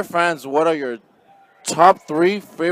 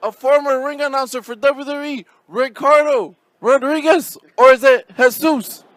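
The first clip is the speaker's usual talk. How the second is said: announcer voice; 8 semitones higher, 4 dB louder than usual. Acted. Host announces guest like announcer.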